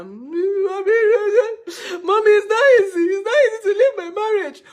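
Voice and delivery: high, loud, tearful whining to mommy; mock crying wail. Daughter whining tearfully to her mother, mock crying. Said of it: "Every time I'll be complaining to them".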